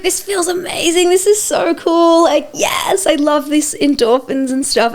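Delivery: high, bubbly gushing; giddy excited. Retold or imitated. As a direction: gush like you're on a high. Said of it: "And I was like".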